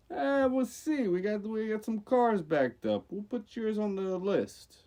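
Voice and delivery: a Jersey guy at the garage; Jersey accent. Retold or imitated. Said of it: "I made it sound like a Jersey guy".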